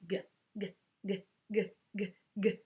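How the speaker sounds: throaty gurgling g sounds like a sink drain. Shown as a demonstration. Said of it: "goes around in the sink like this".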